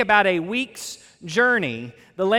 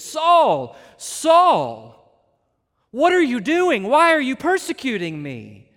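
higher and louder, pleading with feeling; emphatic repeated call; 4 semitones higher, 4 dB louder, slower than usual. Retold or imitated. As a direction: call the name again with urgent emotion. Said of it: "emotionally and emphatically, he repeats it".

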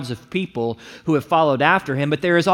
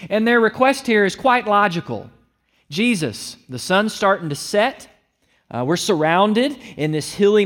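preaching at full force; 5 semitones higher than usual. Acted. Disciples voiced in sermon.

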